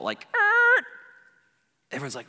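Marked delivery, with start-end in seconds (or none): 0.3-0.8 very high, loud screech noise; record screech. Retold or imitated. Preacher mimicking the movie soundtrack stopping dead, screech. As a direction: imitate music screeching to a halt. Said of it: "the music screeches to a halt".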